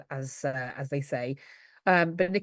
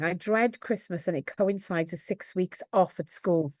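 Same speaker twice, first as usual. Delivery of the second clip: ordinary talk, nothing marked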